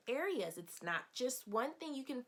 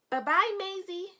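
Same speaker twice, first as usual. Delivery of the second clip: high, loud sing-song farewell; cheery goodbye; 9 semitones higher, 10 dB louder than usual. Acted. Teacher waving goodbye to Macy for the children. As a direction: wave the character off brightly.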